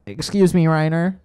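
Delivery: a lightly nasal-free nerdy pedant; nerd voice. Acted. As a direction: correct him like a fussy nerd. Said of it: "Is that your nerd voice?".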